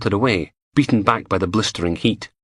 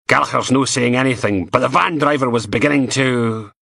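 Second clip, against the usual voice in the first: higher, louder grumbling boss; grumpy inspector; 6 semitones higher, 3 dB louder than usual. A narrator's reading. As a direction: moan back at him, irritated. Steele grumbling.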